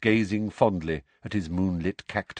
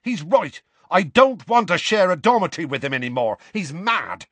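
angry scorn; 8 semitones higher, 4 dB louder than usual. A narrator's reading. Seamus continuing his angry outburst.

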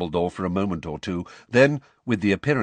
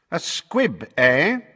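suspicious; 9 semitones higher, 5 dB louder, much slower than usual. Acted. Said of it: "eyeing her suspiciously".

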